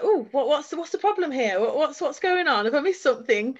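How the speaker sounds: imitating the commissioner acting clueless; mocking the commissioner. Retelling the commissioner's fake confusion, mock puzzled. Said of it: "and he was like".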